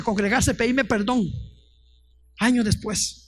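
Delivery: usual speaking voice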